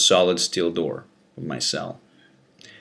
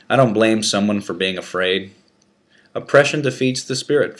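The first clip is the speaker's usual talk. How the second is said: plain reading voice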